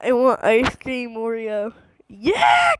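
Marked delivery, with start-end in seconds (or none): 2.1-2.7 1 excited squeal. Real, not acted. Excited cheer while playing.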